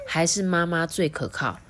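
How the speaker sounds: usual speaking voice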